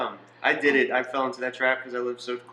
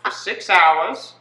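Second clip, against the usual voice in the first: put-on high, slow, terrible German accent; evil scientist in drawn-out mock German; Doctor Mengele; 6 semitones higher, much slower than usual. Evil scientist announcing his experiment, high mock German accent. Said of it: "such a terrible German accent".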